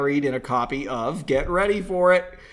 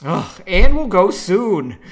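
a slow, louder, fed-up groan of a line; exasperated; 3 semitones higher, 10 dB louder, slower than usual. Acted. Henry fed up with Margot's questions, exasperated and slow. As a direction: drag it out, sick of being sidetracked. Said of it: "Henry grew exasperated".

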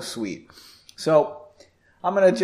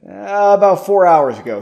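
loud, higher, eager interjection; quick reply; 4 semitones higher, 11 dB louder than usual. A narrator's reading. Stephen answering Janie before Erin can, quick loud reply. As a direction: jump in promptly with the answer. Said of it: "Stephen said quickly".

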